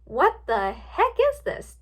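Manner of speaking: slow, high, indignant demand; snapped. A narrator's reading. Twilight confronting Rainbow Dash, indignant snap. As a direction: stretch it out in exasperation. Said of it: "Twilight snapped".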